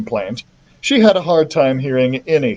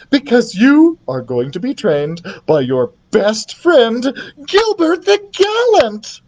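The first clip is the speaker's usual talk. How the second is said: high announcement rising to a squeal; excited queen; 10 semitones higher than usual. A narrator's reading. Queen reveals son's trainer, excited. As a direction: build to a high, thrilled reveal. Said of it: "The queen was so excited".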